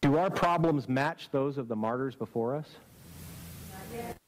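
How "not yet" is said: hushed. Acted. Repeated answer, dropped to a hush.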